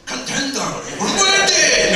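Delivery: a hint of a Scottish Gladiators announcer; lightly voiced Scottish referee call; Scottish accent. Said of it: "wish I could do a Scottish accent".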